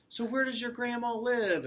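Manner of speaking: high-pitched wolf character voice; prying wolf. A narrator's reading. Wolf questions the girl.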